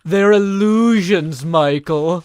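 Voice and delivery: loud, drawn-out indignant impression; Job. Streamer showing off an impression to viewers, loud theatrical protest. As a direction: shout it theatrically, stretching the words. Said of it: "I do an excellent Job impression".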